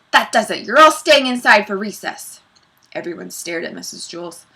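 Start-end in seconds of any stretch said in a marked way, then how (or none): 0.1-2.4 raised, louder teacher's voice; angry scolding. A narrator's reading. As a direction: lay down the law, voice raised.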